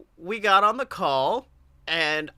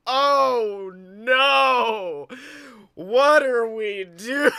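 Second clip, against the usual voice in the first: louder, drawn-out mock dread; slow-mo horror; 4 semitones higher, 5 dB louder, much slower than usual. Host retells realizing co-host is drunk, slow-mo dread. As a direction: stretch every word like slow motion. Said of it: "It was just like a slow-mo".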